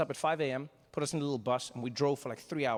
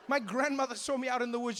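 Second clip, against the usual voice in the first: normal talking voice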